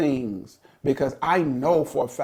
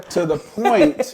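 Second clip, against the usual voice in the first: laughing; 5 semitones higher, 8 dB louder, slower than usual. Real, not acted. Laughing mid-story.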